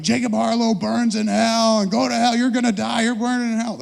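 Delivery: high, loud heckling chant; yelling crowd. Retold or imitated. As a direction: shout it like an angry picket line. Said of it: "yelling shit out".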